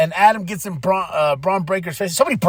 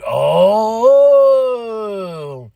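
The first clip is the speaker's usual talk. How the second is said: long, high, loud swooping oh; hammed-up drawn-out astonishment; scandalized reaction; 5 semitones higher, 6 dB louder than usual. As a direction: stretch out a dramatic gasp of intrigue. His reaction to the accusation.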